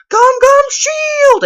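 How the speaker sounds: very high, drawn-out fan yell; long, piercing mock battle shout; yelled battle cry. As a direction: scream it like an anime attack name. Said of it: "yelling out".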